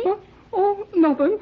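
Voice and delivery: high laughing "nothing". Acted. Boy fibbing to Joan, giggling through "nothing". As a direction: try to deny it while giggling.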